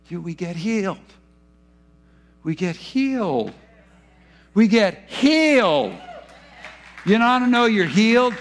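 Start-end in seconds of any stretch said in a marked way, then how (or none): 2.4-5.9 slow swelling proclamation. Real, not acted. Preacher declaring healing, slow and rising. 7.0-8.3 loud, high joyful preaching shout. Real, not acted. Preacher shouting healing to the congregation, loud and joyful.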